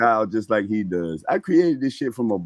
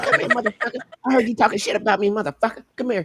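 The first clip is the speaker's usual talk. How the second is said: high, fast, threatening boxer taunt; Mike Tyson impression; 8 semitones higher, faster than usual. Host retelling Tyson confronting him, high mock threat. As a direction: taunt him like an angry champ calling you out. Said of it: "That's when he's like".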